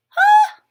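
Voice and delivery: huge high mock sneeze, loud. Reading to kids, acts out a classmate's loud sneeze. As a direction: blast out a fake sneeze. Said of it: "someone sneezed".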